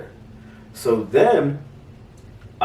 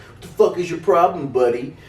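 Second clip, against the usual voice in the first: high, mock challenging tough guy; aggressive stranger; 7 semitones higher than usual. Cigar guy confronting.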